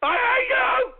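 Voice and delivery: high cracked scream, slow at the end; screaming hatred. Acted. Host screaming at his callers, voice cracking. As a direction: scream it as your voice gives out.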